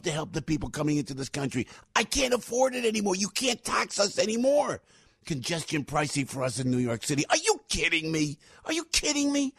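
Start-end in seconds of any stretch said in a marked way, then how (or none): 1.9-4.8 high-pitched exasperated protest; fired up. Real, not acted. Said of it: "I get fired up". 7.3-9.5 high, incredulous outburst; fired up. Real, not acted. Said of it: "I get fired up".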